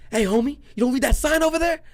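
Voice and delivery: high, loud challenge; confrontational neighbor. Retold or imitated. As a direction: imitate an irate neighbor calling someone out. Neighbor confronting him over the sign.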